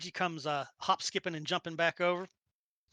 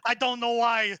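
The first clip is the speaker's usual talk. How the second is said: put-on loud, brash Brooklyn accent; hammed-up Brooklyn voice; 3 semitones higher, 9 dB louder than usual. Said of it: "speaking with a Brooklyn accent".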